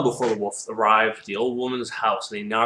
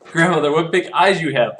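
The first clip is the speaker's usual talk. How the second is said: put-on louder, higher child of wonder; amazed little girl; 6 semitones higher, 8 dB louder than usual. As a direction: exclaim at grandmother, pitched up.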